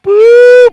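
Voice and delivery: high, loud spooky boo; high loud ghostly boo. Acted. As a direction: shout it to scare the bear. Ghost scaring the bear.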